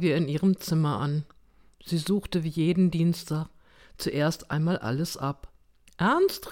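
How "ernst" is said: high, questioning call; raised-pitch searching call. A narrator's reading. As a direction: call for someone lost in the room. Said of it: "rief sie".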